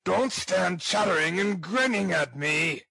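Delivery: angry, impatient ruffian's rebuke. Acted. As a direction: snap it out as an exasperated bully. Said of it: "replied Sikes impatiently".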